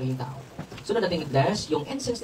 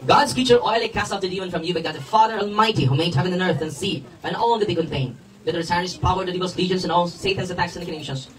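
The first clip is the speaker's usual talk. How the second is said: loud, forceful recited priestly blessing; liturgical blessing prayer; 3 semitones higher, 5 dB louder than usual. Real, not acted. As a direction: pray the blessing out loud over the congregation.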